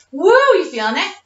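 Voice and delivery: high, loud, fast cheer; excited. Real, not acted. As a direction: pump up the class. Trainer cheering mid-workout.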